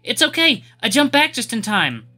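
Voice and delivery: high, loud call from behind a wall. Acted. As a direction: shout reassurance through rubble. Jenny calls through the wall.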